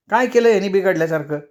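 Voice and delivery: mother snapping back quickly; defensive mother. Acted.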